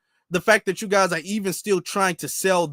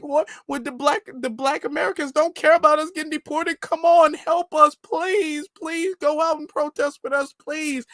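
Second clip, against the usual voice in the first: much higher, whiny mock plea; mocking pleading voice; 8 semitones higher than usual. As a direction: parody a begging online video, pitched way up. Mocks pleas again.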